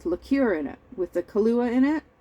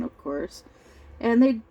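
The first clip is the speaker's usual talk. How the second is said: usual speaking voice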